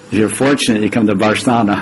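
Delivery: ordinary delivery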